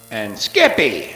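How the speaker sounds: knowing stress on "Skippy"; emphasized name. Shown as a demonstration. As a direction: lean on "Skippy" to give it away. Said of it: "by emphasizing the key names".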